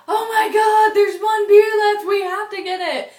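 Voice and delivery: high, loud excited shout; squealing drunk excitement, way up and loud; drunken excitement. Retold or imitated. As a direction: shout it like the last beer is treasure. Spotting last beer in pool.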